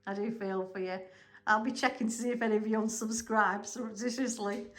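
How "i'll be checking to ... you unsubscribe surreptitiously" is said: ordinary delivery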